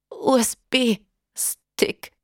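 slow, gasping dying voice; gasping for breath. A narrator's reading. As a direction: struggle for each breath. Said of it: "Nach Atem ringend". (nach Atem ringend)

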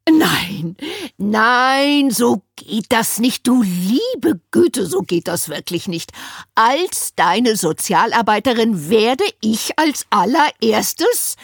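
tut-tut like a fussy auntie; overbearing social worker, appalled; Winnie. A narrator's reading. Winnie's voice held.

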